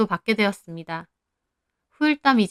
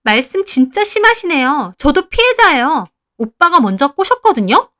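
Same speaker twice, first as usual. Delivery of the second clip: loud, high indignant retort; tearful protest; 7 semitones higher, 8 dB louder than usual. A narrator's reading. Heeju protests to the mother-in-law, indignant and tearful. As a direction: argue back hotly, wounded and accusing. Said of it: "눈물이 그렁그렁해서 따졌습니다".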